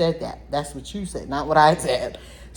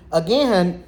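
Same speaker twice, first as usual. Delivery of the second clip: normal talking voice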